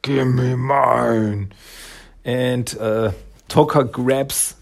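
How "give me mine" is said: slow, growling monster demand; angry werewolf growl. Retold or imitated. Raza in angry mode, demanding the bandana in a slow growl. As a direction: drag it out like a raging beast. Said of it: "he really in angry mode".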